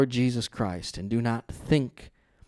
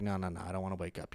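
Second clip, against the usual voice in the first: drowsy refusal; 6 semitones lower, 10 dB quieter, faster than usual. Pretend. Voice refusing to wake.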